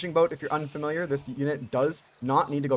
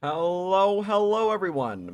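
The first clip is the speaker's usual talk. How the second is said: higher, louder drawn-out hello of a show host; hyped caster greeting; 7 semitones higher, 5 dB louder, slower than usual. Acted. Caster greeting his YouTube viewers, hyped announcer voice. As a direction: open the show like a big announcer.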